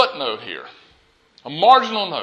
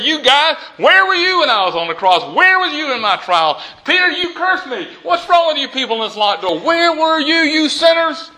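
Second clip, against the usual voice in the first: mock berating; 9 semitones higher than usual. Imagined Jesus berating the disciples who deserted him, high and accusing. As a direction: scold them hard, pitch climbing well above normal. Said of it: "condemn them or judge them or berate them".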